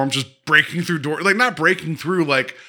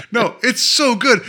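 ordinary talk

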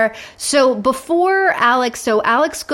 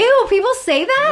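disgusted surprise; 13 semitones higher than usual. Real, not acted. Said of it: "reacts to no bald guys".

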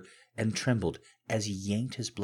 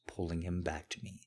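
ordinary delivery

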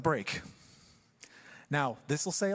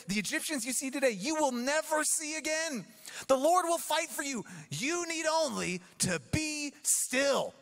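rousing Moses; 8 semitones higher, slower than usual. Acted. Reading Moses' speech, rising.

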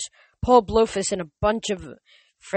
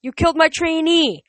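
high and much louder outraged yell; furious yell; 10 semitones higher, 6 dB louder than usual. Acted. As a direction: scream it at the hero. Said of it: "Kelly yelled".

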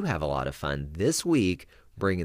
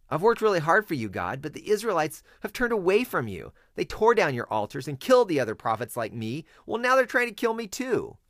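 much higher, whiny complaint to God; complaining Elijah; 10 semitones higher, 3 dB louder than usual. Acted. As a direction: plead like a worn-out prophet at the end of his rope.

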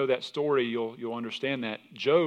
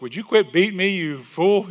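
higher, louder scolding donkey voice; mock donkey scolding its rider, higher and louder; talking donkey; 7 semitones higher, 10 dB louder than usual. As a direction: play the fed-up donkey telling off its master. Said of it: "the donkey's like".

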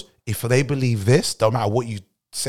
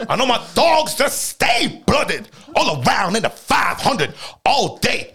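hyped shout; 10 semitones higher, slower than usual. Acted. Hyped crowd chant.

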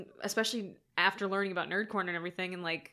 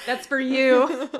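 laughing; 3 semitones higher, 9 dB louder, slower than usual. Real, not acted. Laughing through taunt.

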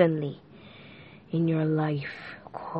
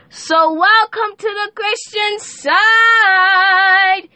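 grand welcome; 10 semitones higher, 4 dB louder, much slower than usual. Acted.

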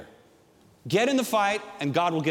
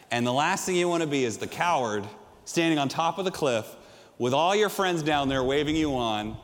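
ordinary talk, nothing marked

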